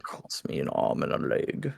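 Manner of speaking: whispered aside. Real, not acted. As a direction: breathe it under your voice. Worried aside under breath.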